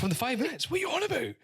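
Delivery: high, disbelieving retort; incredulous. Retold or imitated. Retort to the woman on the plane, incredulous. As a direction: scoff in disbelief.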